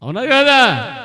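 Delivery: high, loud call for agreement; rousing preacher's challenge; demanding shout. Real, not acted. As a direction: push the crowd to answer. Preacher demands congregation's loud answer.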